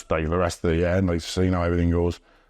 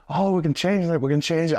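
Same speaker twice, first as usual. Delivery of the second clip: higher, eager voice imitating Johnny; eager imitation; 9 semitones higher than usual. Imitating Johnny offering to change the match, eager and higher. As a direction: pitch it up like a keen, obliging veteran. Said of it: "bless Johnny was like".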